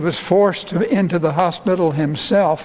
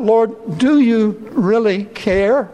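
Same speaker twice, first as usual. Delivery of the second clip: raised, questioning plea; mild cry out; 4 semitones higher, slower than usual. Retold or imitated. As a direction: ask God as if hurt and doubting. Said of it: "And so we cry out".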